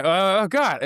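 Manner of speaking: hammed-up catastrophizing moan; mock existential panic. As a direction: spiral into a pretend anxious wail. Acts out spiralling adult anxiety.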